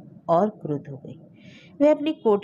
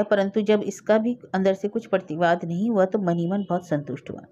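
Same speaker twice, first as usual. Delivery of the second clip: plain reading voice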